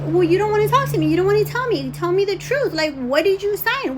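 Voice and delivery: high, loud, insistent retort; retold exasperated demand; heated confrontation. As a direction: argue back at a daughter, demanding answers. Acted quarrel, mother confronting daughter.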